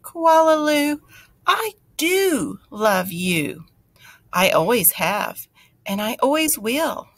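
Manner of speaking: high, slow, loving words from a mother; tender mother. Acted. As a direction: say it as if hugging your child.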